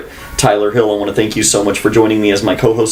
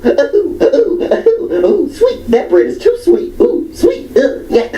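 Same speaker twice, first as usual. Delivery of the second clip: very high squealing ooh sweet, laughing through it; shrieking picky woman; slower than usual. Retold or imitated. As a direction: squeal like a twitching fussy diner. Imitating woman twitching over bread.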